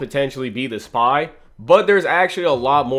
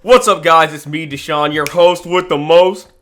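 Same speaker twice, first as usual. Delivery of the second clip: loud hyped YouTuber intro voice; generic YouTuber voice; 4 semitones higher, 6 dB louder than usual. Pretend. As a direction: pump it up like a YouTube host. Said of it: "I hope y'all are loving my generic YouTuber voice".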